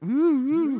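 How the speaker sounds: high-pitched teasing "ooh"; mock flirtatious oohs; suggestive ooh. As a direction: coo it high, mimicking a flirty look. Mimicking two characters eyeing each other.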